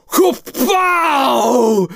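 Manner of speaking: high, loud comic-book blast; stretched, booming "pow" exclamation; explosive kapow. Acted. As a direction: shout it like a mind exploding. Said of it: "everyone's like".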